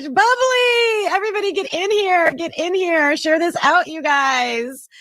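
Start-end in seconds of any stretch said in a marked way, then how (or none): 0.0-3.2 joyful yell to bring the bubbly; excited shout. Real, not acted. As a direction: shout it across the room. Calling for celebration.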